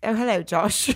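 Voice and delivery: put-on English accent greeting; airy English-accented hello. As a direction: play a star with an affected English accent. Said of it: "let's do our [name] thing".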